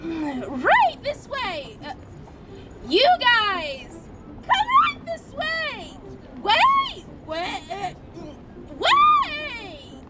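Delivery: high, bright straining elf voice; christmas elf. Acted. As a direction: squeal it like a cheery elf. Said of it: "practicing my elf voice".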